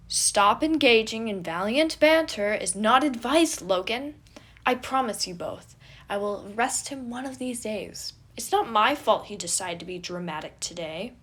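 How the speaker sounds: loud, huffy prince; indignant prince. A narrator's reading. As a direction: protest with wounded pride.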